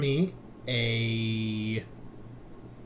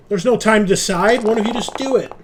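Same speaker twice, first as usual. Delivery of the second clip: urgent push; 8 semitones higher, 11 dB louder, faster than usual. Real, not acted. GM urges players, loud.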